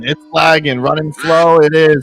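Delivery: high, loud play-by-play call; live race commentary. Acted. Streamer calls the duck race to the chat, high loud play-by-play. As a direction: keep the race call going, hyped.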